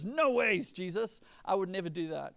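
loud, cocky denial; self-confident. Retold or imitated. Peter boasting in front of Jesus, loud and cocky. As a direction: brush off Jesus' warning with swagger. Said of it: "Peter's so self-confident".

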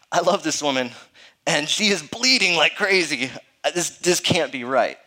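loud, alarmed inner monologue; mock alarm. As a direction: act out a husband's overwhelmed disbelief. Acting his alarmed birth-room thoughts.

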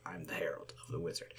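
conspiratorial whisper. Acted. Whispering his title to the lady. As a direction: lean in and breathe it. Introducing himself secretively to Eldra.

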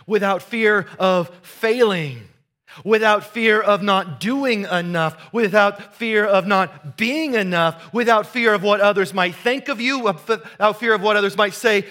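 rising preaching. Real, not acted. Preaching climax, repeated refrain.

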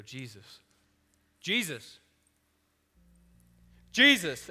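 building from hushed to a frantic yell; panicked cry. Acted. Panicking disciples in a storm.

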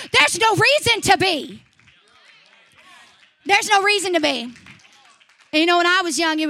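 preacher exhorting a downcast room; full-force preaching. Acted. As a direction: press it home to the crowd. Exhorting the congregation.

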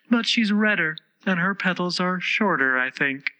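high-pitched, mannered flower voice; prim Rose voice. A narrator's reading. The Rose explains manners to Alice, prim and high. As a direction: lecture primly, pitched high.

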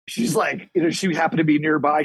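normal talking voice

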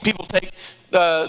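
ordinary delivery

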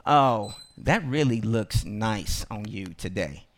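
low, soft mocking voice; sarcastic compliment. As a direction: say it low and quiet, dripping with fake niceness. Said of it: "Somebody telling you that you look nice today, but they go".

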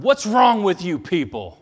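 loud, high exasperated yell; yelled rebuke. Retold or imitated. Sproul turning on the crowd, yelling loud and high. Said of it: "And he yelled at 'em".

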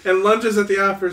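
ordinary talk, nothing marked

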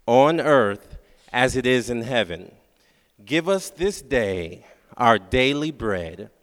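slow, solemn, low congregational prayer; prayer recitation. Acted. Pastor leading the congregation in the Lord's Prayer, solemn and slow. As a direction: lead the church through the Lord's Prayer, slowly.

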